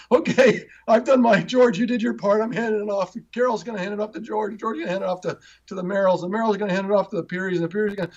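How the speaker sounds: mock handoff. Picturing everyone passing him along like a relay, high excited mock voice. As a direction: play each helper cheerfully handing the job on. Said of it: "It's almost like if they planned it".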